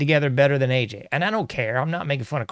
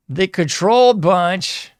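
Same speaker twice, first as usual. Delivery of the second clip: louder, higher, slow announcement; showman announcement; 5 semitones higher, 5 dB louder, slower than usual. Acted. Host introducing his favorite segment to viewers, slow, loud showman announcement. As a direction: announce it like a show title.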